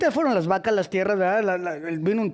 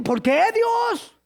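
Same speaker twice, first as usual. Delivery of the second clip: Job crying out, slow and high; a long anguished cry to God; pleading shout; 11 semitones higher, much slower than usual. Acted. Job shouting his complaint at God, anguished.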